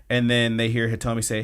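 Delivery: ordinary talk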